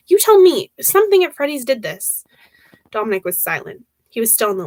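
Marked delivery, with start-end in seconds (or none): 0.1-2.2 louder, higher, demanding answers; angry accusation. Acted. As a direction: throw the blame at him. Angel accuses Dominic by phone.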